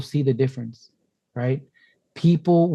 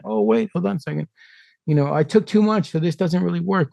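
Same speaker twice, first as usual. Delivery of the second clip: high, put-on voice of a puzzled beginner; lightly mocking, puzzled; mock confused user; 6 semitones higher than usual. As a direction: play the flustered newbie. Mocking confused microdoser quote.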